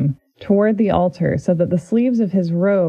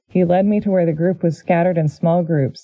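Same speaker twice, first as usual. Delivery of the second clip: ordinary talk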